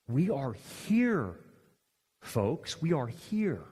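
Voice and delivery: slow, grave and hushed; solemn. Real, not acted. Preacher telling the congregation, slow and grave.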